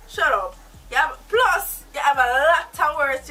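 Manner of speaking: loud scolding snap at the chatty captive; angry shout. Acted. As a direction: slap the words down to silence him. Captor snaps at the captive.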